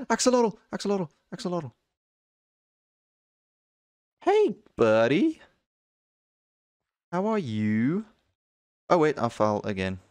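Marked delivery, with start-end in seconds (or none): 0.1-1.7 high, quick repeated cry of delight; excited outburst. Real, not acted. Gamer suddenly spotting an axolotl, excited outburst. 4.2-8.1 high, drawn-out pet-talk voice; cutesy greeting. Real, not acted. Gamer greeting an axolotl, cutesy pet talk.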